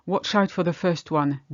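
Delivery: ordinary delivery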